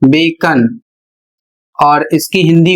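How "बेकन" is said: बेकन said as "bekn", the o silent; correct English pronunciation. Shown as a demonstration. Teacher modelling the silent o for the class, बेकन said bay-kn. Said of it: "इसका उच्चारण होता है".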